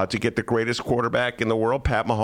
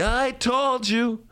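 high, slow told-you-so whine; 11 semitones higher, much slower than usual. Retold or imitated. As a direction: gloat it slowly. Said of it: "I'm getting the".

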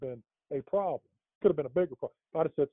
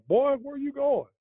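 higher, slow, suspicious cop challenge; imitating the cop; 13 semitones higher, 5 dB louder, slower than usual. Retelling how the cop at the stop questioned the driver, mock suspicious. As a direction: mimic a small-town cop drawing out the question, pitched up.

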